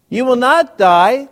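slow, emphatic "You will not die"; a serpent insisting slowly and loudly; loud slow serpent line. Acted. Serpent's lie read aloud.

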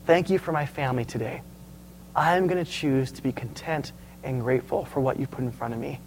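soft, low and unhurried thanks; quiet prayer with pauses between each thanks; grateful prayer. Pretend. Preacher models thanking God for food, shelter and family, quietly grateful.

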